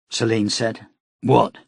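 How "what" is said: growled. A narrator's reading. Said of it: "he growled".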